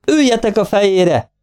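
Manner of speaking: jóval magasabb hangú, parancsoló kiáltás; üvöltés. A narrator's reading. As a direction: felolvasott üvöltés, mérsékelt hangerővel. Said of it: "üvöltötte". (sürgető kiabálás emelt hangon)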